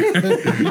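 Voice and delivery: high cackling villain laugh; evil villain laugh. Real, not acted. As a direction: cackle like the Joker. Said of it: "You sound like an evil villain".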